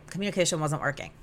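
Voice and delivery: ordinary talk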